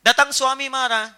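usual speaking voice

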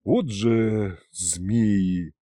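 very slow, drawn-out low exclamation; catching breath. A narrator's reading. Hero stopping in the corridor, catching breath. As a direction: draw it out while recovering breath. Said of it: "отдыхиваясь в коридоре".

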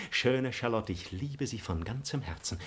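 hushed, ardent confession; softly wooing lover; tender love declaration. Acted. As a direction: drop to a soft, intimate avowal. Suitor declares his love.

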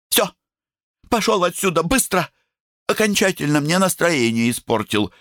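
высокий обиженный голосок; прогони брата, надувшись; надутая девочка. A narrator's reading. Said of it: "надулась Коколи". (обиженный девичий голос)

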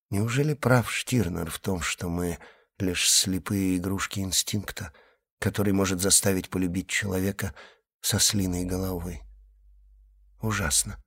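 soft, quiet musing; inner thought. A narrator's reading. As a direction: think it aloud softly. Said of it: "Эльза подумала".

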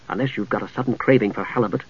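soft, low dry aside. Acted. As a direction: keep it down between companions. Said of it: "he asked in a low voice".

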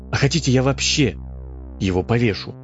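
ordinary delivery

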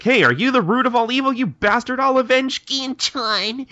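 very high, loud mock fury; hammed-up vengeful shout. Kagura swears to avenge Gin, hammed-up vengeful shout. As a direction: cry out a melodramatic vow, stretching the end. Kagura vows revenge on the culprit.

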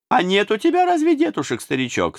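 high, sweetly teasing tsar; mock-kind tsar. A narrator's reading. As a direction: coo it down at the old servant like a mocking tsar. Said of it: "спросил Иоанн ещё милостивее".

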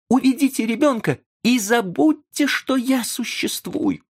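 very high, anguished pleading outcry; desperate cry. A narrator's reading. Young man begs the professor to leave, crying out in despair. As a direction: cry it out in despair, pitched right up. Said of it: "в отчаянии вскричав".

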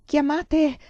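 forcing the word out through fear. A narrator's reading. (chiamate balbettato con paura, più alto)

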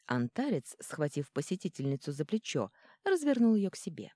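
ordinary delivery

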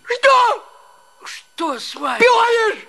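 shout. Acted. Husband snaps at wife.

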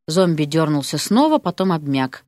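plain reading voice

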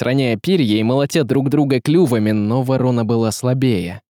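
ordinary delivery, nothing marked

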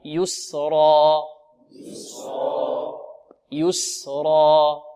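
very slow "يسرا", final vowel held and left open; open-ended alif drill. Shown as a demonstration. Teacher leading students to repeat, slow recitation. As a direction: stretch "يسرا" for others to echo. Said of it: "انطقوا معي".

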